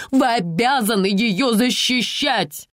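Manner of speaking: slow, insistent exclamation; exclaiming. Acted. Mother insists the police protect her daughter, mild exclamation. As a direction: press the point on the police. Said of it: "воскликнула Инна Владимировна".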